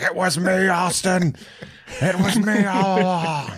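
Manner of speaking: high, hammed-up wrestling villain reveal; Vince McMahon. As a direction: bellow it like a mock wrestling boss unmasked. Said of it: "it's Vince McMahon, and he's like".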